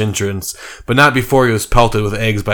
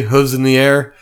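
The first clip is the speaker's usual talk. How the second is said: ordinary delivery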